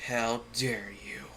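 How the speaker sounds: slow, soft menacing whisper; hushed sinister Snape. A narrator's reading. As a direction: breathe it out menacingly. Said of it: "demanded Professor Snape".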